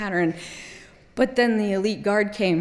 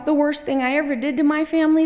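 ordinary talk

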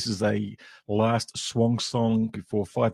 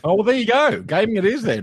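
high, loud mock surprise; a bright amused oh well; surprised; 12 semitones higher, 8 dB louder than usual. Real, not acted. New word supplied by cohost.

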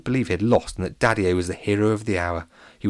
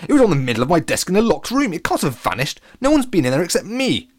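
agitated, raised protest; Latham Lashington; 9 semitones higher, 5 dB louder than usual. A narrator's reading. Latham finds his trophy has vanished, agitated. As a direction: bluster in high disbelief. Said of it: "visibly agitated Latham Lashington".

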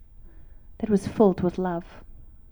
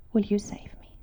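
soft, unvoiced plea to God; whispered prayer; 6 dB quieter than usual. Praying to God for rescue, a whispered plea. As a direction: breathe the prayer out.

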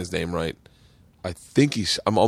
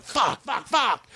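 high-pitched cursing; mock agitation; 13 semitones higher, much slower than usual. Comic comes offstage wound up, mock agitated. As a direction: imitate a worked-up comic swearing. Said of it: "so much energy coming off".

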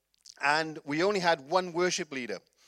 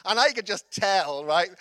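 ordinary delivery

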